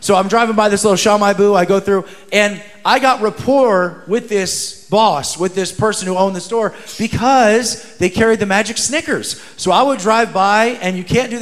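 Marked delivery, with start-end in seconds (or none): none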